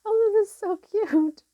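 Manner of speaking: high, cooing over something cute. Real, not acted. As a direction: coo like at a kitten. Reader cooing at cute card.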